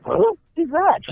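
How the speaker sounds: high-pitched baffled question; comically incredulous; mock bewilderment. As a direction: act stunned, pitch jumping right up. Acting out his stunned reaction.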